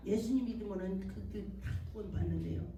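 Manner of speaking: ordinary talk, nothing marked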